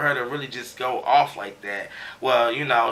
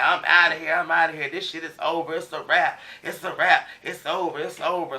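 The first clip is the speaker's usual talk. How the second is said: higher, raised mock yell of quitting; retold storming out; 5 semitones higher, 3 dB louder than usual. A cast member storming out of the house, voiced higher and mock furious. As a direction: act out her storming off, fed up.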